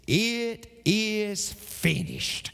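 very slow, each word laid down heavy; hushed and slow, the final word on the cross; solemn dying words. Acted. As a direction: let it land like the last breath of Jesus. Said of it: "he said".

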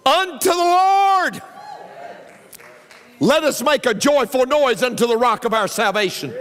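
soaring preacher's shout; preaching shout. Acted. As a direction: belt the psalm out to the Lord. Preacher sings/shouts the psalm.

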